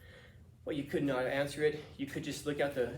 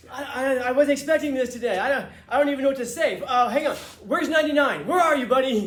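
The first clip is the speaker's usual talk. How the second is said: much higher and louder, breathless excitement; giddy winner's speech; 10 semitones higher, 11 dB louder than usual. Retold or imitated. Winner thanking the cheering restaurant, loud giddy excitement. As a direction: gush like a surprised award winner. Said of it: "he's like".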